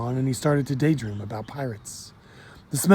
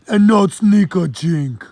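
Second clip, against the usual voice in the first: slow, louder, higher Jamaican warning; Jamaican accent; 6 semitones higher, 8 dB louder, slower than usual. Acted. Kofi warns the boy not to drink, Jamaican accent. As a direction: wag a finger in patois. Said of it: "heavy accent".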